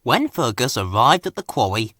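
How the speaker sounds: plain reading voice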